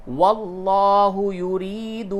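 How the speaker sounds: واللہ یرید with heavy lam and drawn-out یرید; full lam. Shown as a demonstration. Teacher demonstrating the long ya in یرید, slow. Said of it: "اس کو پُر کر کے پڑھیے".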